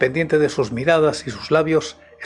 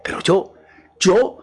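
raised, higher outburst; grito fuera de sí; 5 semitones higher, 3 dB louder, slower than usual. A narrator's reading. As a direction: cry out, beside yourself. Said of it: "gritó Morrel fuera de sí". (grito exaltado, fuera de sí)

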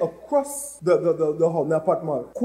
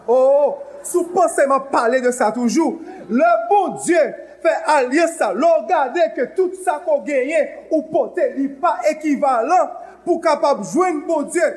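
loud, high preacher's call and response; rising sermon chant, pounding each line; preaching at full force; 7 semitones higher, 4 dB louder than usual. Acted. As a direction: shout it out like a revival preacher.

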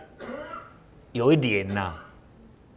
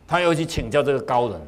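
ordinary delivery, nothing marked

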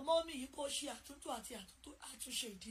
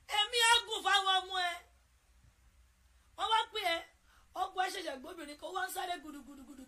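impassioned preaching; 4 semitones higher, 8 dB louder, faster than usual. Real, not acted. Preaching at full force.

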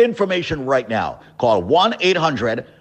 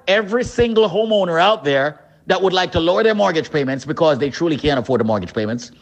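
ordinary delivery

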